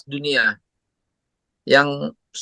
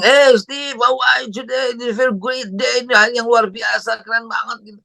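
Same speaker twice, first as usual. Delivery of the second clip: much higher, cheery sing-song call; overly upbeat morning caller; excited greeting; 9 semitones higher than usual. Retold or imitated. Friend's cheery call retold.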